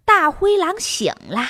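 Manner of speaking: plain reading voice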